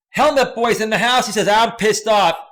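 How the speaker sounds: loud, high shout-out to chat; hyped read-out. Retold or imitated. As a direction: belt out the viewer's comment.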